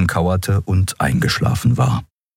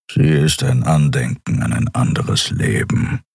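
a taciturn fighter speaking sparingly; low gruff voice answering Endriel; gruff Keru; 6 semitones lower than usual. A narrator's reading.

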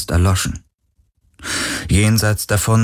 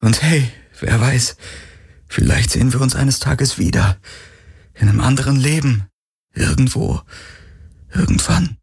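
higher, hopeful despite crying; brave through tears; 6 semitones higher than usual. Acted. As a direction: smile through tears to say goodbye. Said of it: "Tränen tropften von seinem Kinn".

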